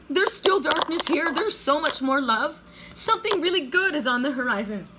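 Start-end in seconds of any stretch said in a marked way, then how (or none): none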